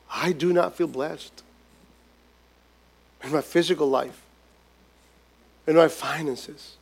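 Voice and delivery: hushed, low, downcast prayer; quiet lament. Acted. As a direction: murmur it like a weary believer. Believer's discouraged prayer quoted.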